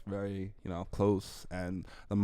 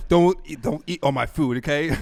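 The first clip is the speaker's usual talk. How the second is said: higher, louder scolding mother; strict mom; 6 semitones higher, 9 dB louder than usual. Retold or imitated. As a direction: bark it like a stingy mom guarding food. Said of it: "She's just like".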